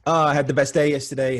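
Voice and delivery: high, loud, quick burst of delight; excited gushing. Real, not acted. Speaker opening the talk about yesterday's great day, excited.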